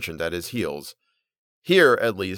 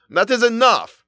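high, loud angry outburst; angry cry; 12 semitones higher, 6 dB louder than usual. A narrator's reading. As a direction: snap at the surgeon to stop. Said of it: "the Turk cried angrily".

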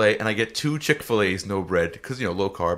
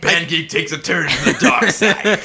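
mock announcer voice; 5 semitones higher, 6 dB louder than usual.

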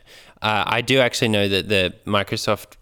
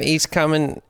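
ordinary talk, nothing marked

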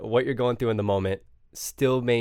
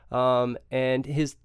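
usual speaking voice

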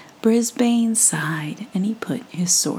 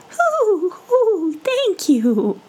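high cooing hoo, suppressed laugh; dove holding back a laugh; 12 semitones higher, much slower than usual. Acted. Mourning dove thanking the mouse, cooing high. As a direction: coo politely while stifling a giggle. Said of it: "trying not to laugh".

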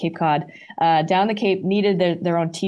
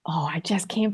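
whispering; 3 semitones lower, 5 dB quieter than usual. Real, not acted. Whispered aside about concert.

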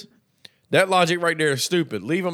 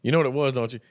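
ordinary talk

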